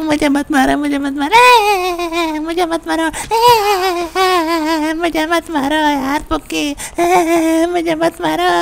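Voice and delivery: mock sobbing plea; hammed-up crying. Shinchan wails while being beaten.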